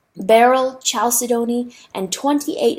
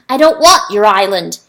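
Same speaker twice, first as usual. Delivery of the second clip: loud angry snap; snapped; 9 dB louder than usual. Acted. Rooster refusing the river, loud angry snap. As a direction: bite it off, furious. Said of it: "he snapped".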